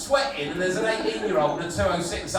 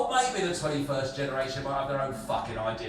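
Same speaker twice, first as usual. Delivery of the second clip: normal talking voice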